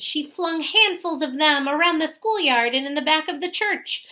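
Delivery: ordinary delivery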